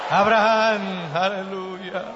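long, loud drawn-out name, easing off; easing exalted call. Acted. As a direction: let the big call settle into praise. Closing the climactic call. (llamado prolongado que se va calmando)

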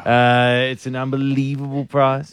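host selling the prize package; live radio prize plug; radio ad read. Acted. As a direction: pitch the hotel and price like a promo. Reads prize details live.

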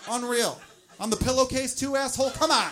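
loud, high-pitched mock outrage; indignant outburst. Acted outrage at the mess.

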